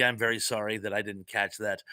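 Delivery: ordinary talk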